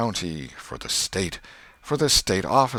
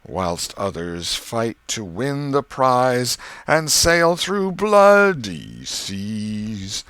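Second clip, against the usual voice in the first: slow, swooping recital, climbing up then plunging down; very slow, sing-song pulpit delivery with strong emphasis on the topmost word; peculiar hymn reading; 4 semitones higher, 4 dB louder, much slower than usual. A narrator's reading. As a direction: read it like a preacher showing off, each line climbing to its top word and diving. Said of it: "His voice began on a medium key, and climbed steadily up".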